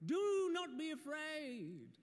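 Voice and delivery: high, slow heavenly pronouncement; grand angel voice. Acted. Angel telling the shepherds not to fear, high and grand. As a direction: proclaim it from above. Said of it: "can't say that line without saying it like that".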